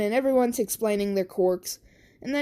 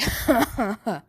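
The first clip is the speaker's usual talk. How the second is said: mocking laugh. Bakugo laughs after attack.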